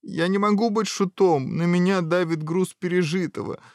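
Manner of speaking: high-pitched, plaintive whine; plaintive protest. Acted. Prince refusing to be a jester, plaintive. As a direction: plead under the burden of woe.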